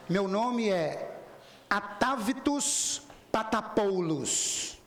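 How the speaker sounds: each syllable drawn out slowly; slow careful name. Retold or imitated. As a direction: spell out the strange name slowly, rehearsed. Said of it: "eu ensaiei pra falar o nome".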